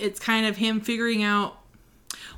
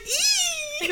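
high little fangirl squeal; excited squeal; 6 dB quieter than usual. Retold or imitated. Reader reacting to a cute scene, delighted squeal. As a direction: squeal thinly at a high pitch.

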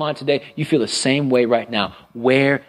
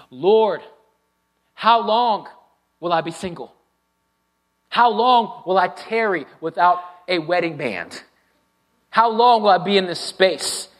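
pleading prayer; 6 semitones higher than usual. Acted. Pleading prayer voiced for congregation.

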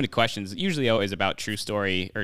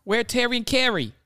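higher-pitched mock Boston accent; Mark Wahlberg's Boston accent; 12 semitones higher than usual. Retelling the movie scene to podcast friends, in a higher mock Boston accent. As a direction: squeak out "Where Terry and Carrie?" like a hammed-up Bostonian. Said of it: "That was the same exact accent".

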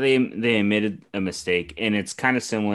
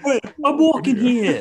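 put-on high, louder laughing New York accent; 6 dB louder than usual. Friends riffing on Draymond, laughing New York accent. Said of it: "Develop a New York accent".

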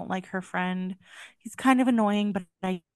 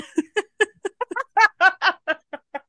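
burst of laughter; 11 semitones higher, 6 dB louder than usual. Real, not acted. Laughs at last line read.

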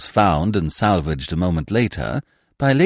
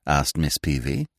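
plain reading voice

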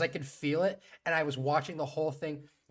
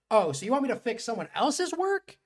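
sassy mock; 5 semitones higher, 8 dB louder than usual. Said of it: "She's like".

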